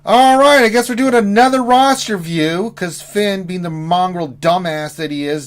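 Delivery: loud, high furious rant; blowing up in anger; angry outburst. Real, not acted. Swearing at absent friend.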